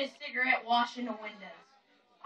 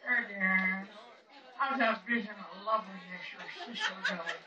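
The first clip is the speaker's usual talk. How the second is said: put-on a lower old hillbilly voice, sly; 6 semitones lower, 5 dB quieter than usual. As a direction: croon it like a lovesick old hillbilly. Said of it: "He always says to me".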